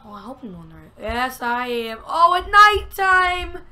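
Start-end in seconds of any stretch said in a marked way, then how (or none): 2.1-3.6 high, drawn-out loud wail; loud dismay. Real, not acted. Gamer realising it's nighttime, loud drawn-out dismay. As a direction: cry out, stretching the last word.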